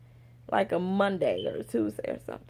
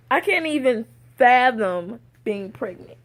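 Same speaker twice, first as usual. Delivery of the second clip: ordinary delivery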